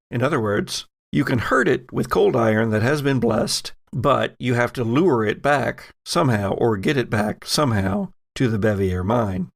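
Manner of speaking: ordinary talk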